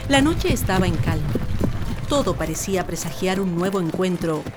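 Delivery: low, measured narrator voice over music; story narration. A narrator's reading. Narrator opening a Chilean legend, low and measured.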